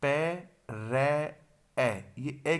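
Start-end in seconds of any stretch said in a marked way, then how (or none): 0.0-1.4 पै, रै said slowly, one syllable at a time; slow syllable drill; syllable-by-syllable sounding out. Shown as a demonstration. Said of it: "ये पै की sound है".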